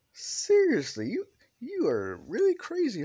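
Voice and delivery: high, loud, incredulous protest; exasperated disbelief. Acted. Deku reacts to being shared, exasperated.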